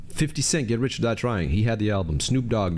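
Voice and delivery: usual speaking voice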